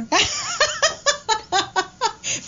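high, long helpless laugh; real laughter breaking out; burst of laughter. Real, not acted. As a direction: laugh it off, amused. DJ laughs at listeners' teasing.

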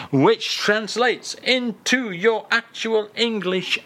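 ordinary talk, nothing marked

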